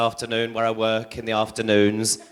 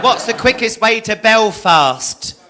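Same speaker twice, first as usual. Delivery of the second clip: higher, louder and slow, calling out; loud slow question; 8 semitones higher, 6 dB louder, slower than usual. Retold or imitated. Lost comic asks an Irish policeman for directions: loud, slow call.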